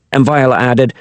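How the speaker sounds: ordinary delivery, nothing marked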